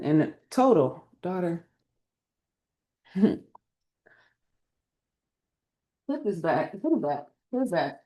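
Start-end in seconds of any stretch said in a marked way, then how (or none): 1.2-8.0 quiet aside. Real, not acted. Turning from viewers to gently scold her daughter, quiet.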